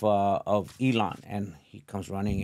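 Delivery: usual speaking voice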